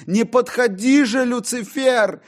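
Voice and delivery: high, loud plea; frightened cry. Acted. John cries out to Lucifer, frightened. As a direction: push the devil away.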